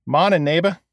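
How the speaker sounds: put-on light Boston accent, affable greeting; a friendly Boston hello across the counter. A narrator's reading. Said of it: "in his affable Boston-infused accent".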